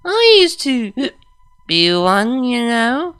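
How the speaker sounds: put-on high, slurred, rambling drunk; slurred drunk speech. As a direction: slur it like she's had too much. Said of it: "she slurred".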